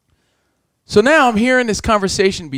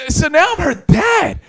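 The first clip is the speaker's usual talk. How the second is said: indignant outburst; 12 semitones higher than usual. Real, not acted. Outraged retelling.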